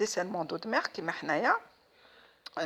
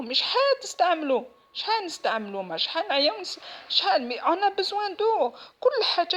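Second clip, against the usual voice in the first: higher and louder rising demands; frustrated outburst; 9 semitones higher, 5 dB louder than usual. Real, not acted. To the authorities about rationing water, indignant. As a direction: fire the questions off angrily.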